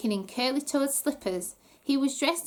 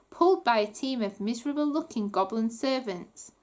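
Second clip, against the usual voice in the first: usual speaking voice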